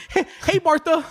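high-pitched mock-cheery hail; neighborly call. Waving at Martha from the car, high cheery greeting. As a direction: call out to a neighbor, playfully overfriendly.

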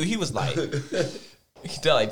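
normal talking voice

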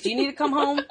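anxious mom checking in; worried mom voice. Retold or imitated. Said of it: "She basically was a mom".

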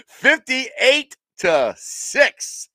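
reading a joke score prediction while laughing. Real, not acted.

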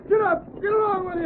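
high-pitched urgent hollering; driving horses. Acted. Driver as rider grabs the harness, urging horses. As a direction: shout the horses into running.

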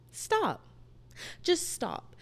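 high-pitched acted plea to stop; put-on high voice of a pleading friend; imagined friend intervening. Voicing a friend who intervenes.

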